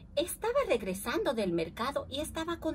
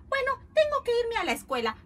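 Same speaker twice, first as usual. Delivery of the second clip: put-on fast, high, louder girl's line; hurried; 9 semitones higher, 6 dB louder, faster than usual. Niece must leave for school, hurried high voice. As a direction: rush it out, pitched up.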